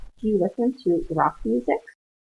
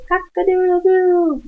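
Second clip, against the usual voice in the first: high, loud "cock-a-doodle-doo" crowing; rooster crow; 11 semitones higher, 8 dB louder, slower than usual. Shown as a demonstration. Teacher demonstrates the English rooster call, crowing high and loud. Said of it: "so in English we would say".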